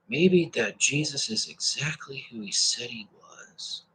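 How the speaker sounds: slow breathless whisper. Acted. Preacher to viewers, slow hushed whisper. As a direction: hush it like a secret confession.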